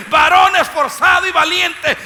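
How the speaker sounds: shouted high and loud; mock shout of the angel's words. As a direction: fling the angel's words back in disbelief. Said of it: "este hombre me viene a decir". (gritando con incredulidad)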